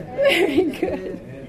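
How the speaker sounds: a laughing, drawn-out very good; laughing praise. Real, not acted. Teacher laughs while praising a student's answer. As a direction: praise the student through a chuckle.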